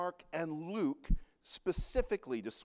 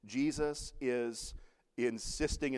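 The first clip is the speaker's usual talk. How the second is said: normal talking voice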